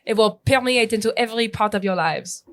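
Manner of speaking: lightly voiced French accent; a hint of a smug Frenchman; mock French accent. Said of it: "my foreign exchange student friends".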